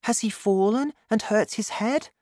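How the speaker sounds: put-on high, anxious boy's voice; high-pitched worried question; worried child. A narrator's reading. Worried child thinking about missing father.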